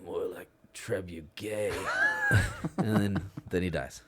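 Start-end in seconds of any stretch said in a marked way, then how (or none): 0.0-1.7 dying gasp. Retold or imitated. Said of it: "He's like".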